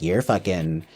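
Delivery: a hint of a cold, sneering guest; low, hushed, needling question; mocking intruder. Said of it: "she's like".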